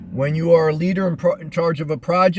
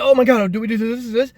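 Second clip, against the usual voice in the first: nervous panic; 6 semitones higher, 3 dB louder than usual. Retold or imitated. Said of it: "and then I get".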